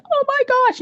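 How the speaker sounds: very high, startled cry; frightened. Acted. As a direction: gasp it out high, acting terrified. Girl sees eyes behind her.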